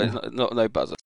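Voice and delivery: usual speaking voice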